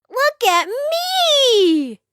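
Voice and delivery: high, slow drawn-out show-off; proud cat admiring himself; stretched out delighted cry. A narrator's reading. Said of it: "he cried".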